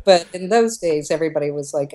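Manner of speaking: normal talking voice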